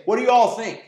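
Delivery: loud mock group poll; a mock call for a vote; mock loud question. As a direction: blurt it out to the room. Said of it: "He's not gonna say".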